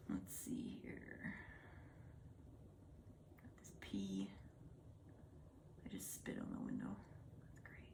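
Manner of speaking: soft muttered whisper. Real, not acted. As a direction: whisper to yourself.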